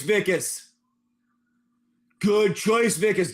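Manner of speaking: ordinary talk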